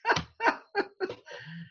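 high real laughter, fading; real amused laugh. Real, not acted. Laughs at her own story.

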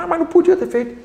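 mocking the friends' "he shouldn't have done it"; mock indignant objection. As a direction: voice their outrage, up high. Friends protesting his forgiveness.

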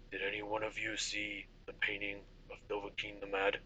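low, soft, gruff-leaning general asking; a hint of a weary soldier; lightly voiced general. General asks the group.

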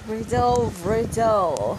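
loud, high riddle call; announcer call. Pretend. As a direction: call it out like a game host.

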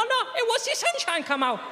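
put-on very high accented reply; Spanish accent. Spanish father explaining drunk crowds, high accented voice. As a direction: answer brightly in broken English. Family answers friends.

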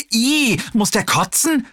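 high, startled squeal of disgust; disgusted shriek. Acted. Nurse recoils from Hassan, who fakes vomiting, squealing high.

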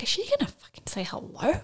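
angry whisper under the breath; whispered thought. Retold or imitated. Mother in labour fuming at the silent midwife, angry whisper. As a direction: hiss the thought to yourself.

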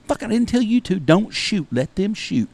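high, exasperated scolding; retold scolding. Guide scolding his dad for stealing the shot, high exasperated. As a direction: tear into him from way up high.

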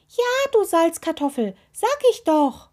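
high, loud exasperated retort; exasperated call. A narrator's reading. Toe insulting the doubting witch, calling out exasperated. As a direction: snap back high as the cranky toe. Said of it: "rief der Rausguckzeh".

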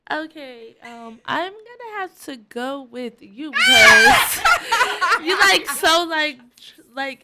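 shouting through laughter; laughing loudly. Real, not acted. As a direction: laugh hard while you accuse. Accusing friend while laughing.

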